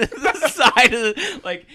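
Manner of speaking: ordinary talk, nothing marked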